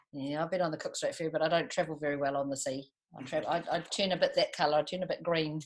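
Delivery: ordinary delivery, nothing marked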